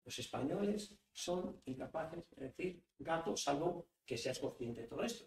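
"gato" said very softly, almost confiding. Shown as a demonstration. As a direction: drop right down and repeat it gently. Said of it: "Fijaos una sola boca". (repetición muy suave)

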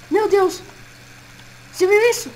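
high, loud scared outcry; frightened shout. Acted. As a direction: yelp in sudden fear. Vê o animatrônico. (grito assustado)

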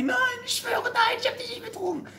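high, desperate denial; pleading denial. Acted. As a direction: squeal your innocence, pitch way up. Wife denies cheating to husband. (hohe, flehende Unschuldsbeteuerung)

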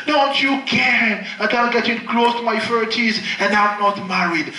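normal talking voice

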